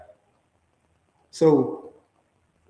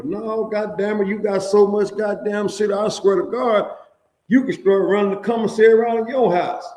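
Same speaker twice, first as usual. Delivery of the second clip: higher, louder, hammed-up shouting guard; mock officer yelling; 8 semitones higher, 5 dB louder than usual. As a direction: bark it like an exasperated officer.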